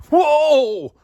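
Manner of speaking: loud, high whoa of astonishment; big amazed exclamation; surprised whoa. Acted. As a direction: gasp it out at the net full of fish. Surprise at the full net.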